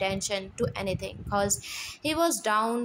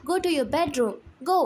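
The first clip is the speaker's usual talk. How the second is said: loud, higher shouted orders; shouting; 6 semitones higher, 5 dB louder, faster than usual. Acted. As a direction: order her out at full voice. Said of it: "They shouting".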